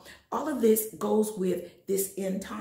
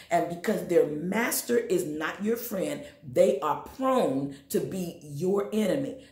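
ordinary talk

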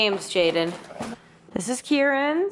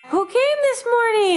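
excited; 10 semitones higher, 4 dB louder than usual. Real, not acted. Excited Christmas morning question.

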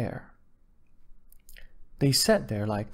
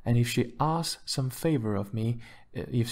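ordinary delivery

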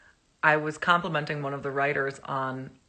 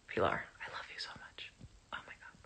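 whisper; 9 semitones lower, 13 dB quieter, faster than usual. Real, not acted. Reading a fan message softly.

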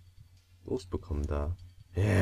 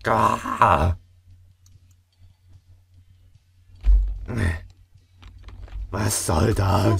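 frustrated; 2 semitones higher, 9 dB louder, much slower than usual. Real, not acted. Frustrated cry at the game.

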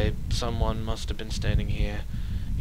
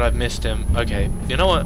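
usual speaking voice

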